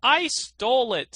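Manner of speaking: high, loud desperate admission; miser's confession. A narrator's reading. Miser confessing to the countryman, high and loud. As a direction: blurt it out in panic. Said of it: "said the miser".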